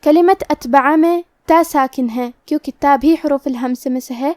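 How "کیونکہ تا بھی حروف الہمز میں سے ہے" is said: ordinary delivery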